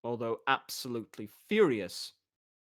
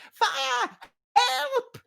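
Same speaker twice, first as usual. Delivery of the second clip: very high, loud alarm; the fleeing doppler raising a false alarm; panicked yell; 4 dB louder than usual. Acted. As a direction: scream for help as you flee. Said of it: "it yelled".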